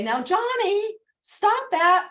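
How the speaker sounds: upswinging plea. Shown as a demonstration. Parent to a child named Johnny, mild upswing plea. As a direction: ask the child nicely, ending up. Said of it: "If you say".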